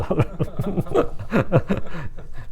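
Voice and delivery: real laugh. Real, not acted. Teasing congregation after question.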